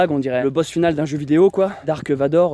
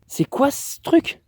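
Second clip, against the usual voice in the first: much higher and slow, drawn out in disbelief; baffled; 10 semitones higher, much slower than usual. Retold or imitated. Vlogger retelling finding the odd object: baffled high drawn-out question. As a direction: stretch it out high, staring at the thing.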